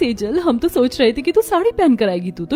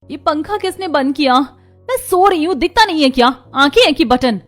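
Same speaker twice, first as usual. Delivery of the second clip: angry outburst; 5 semitones higher, 3 dB louder than usual. Acted. Woken daughter snaps at sister-in-law.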